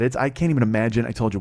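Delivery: ordinary talk, nothing marked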